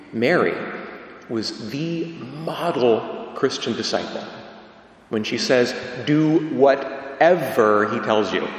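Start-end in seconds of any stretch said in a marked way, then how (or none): 6.1-8.5 "whatever" drawn out slowly with heavy emphasis; slow right down and lean on "whatever"; quoted instruction with "whatever" stretched out; stress on whatever. Acted. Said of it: "put emphasis on the word whatever".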